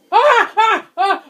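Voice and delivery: high, loud shrieks of real terror; terrified screams. Real, not acted. Gamer jolted by the monster, screaming in terror.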